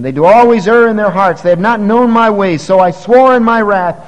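preacher declaiming God's oath; preaching at full force. Acted. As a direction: boom out God's wrath from the pulpit.

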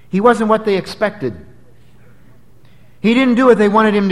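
fervent sermon build; preaching. Acted. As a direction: drive the point home from the pulpit. Preacher at full force.

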